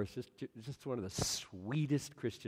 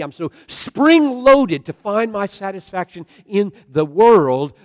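loud, high-pitched sermon at full force; impassioned preaching; 8 semitones higher, 21 dB louder than usual. Acted. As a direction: pour out the list with mounting intensity.

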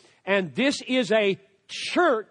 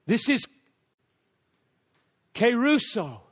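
ordinary delivery

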